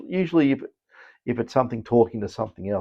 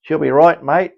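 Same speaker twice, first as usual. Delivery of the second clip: higher, louder broad Australian accent; 5 semitones higher, 7 dB louder than usual. Shown as a demonstration. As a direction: lay the Aussie accent on thick. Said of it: "gotta sort of say it like this".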